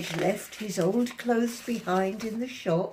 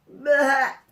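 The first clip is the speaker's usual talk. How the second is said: loud bleating bah; a loud goat's bah; 3 semitones higher, 9 dB louder than usual. A narrator's reading. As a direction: bleat it out like a goat. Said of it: "bleating loudly".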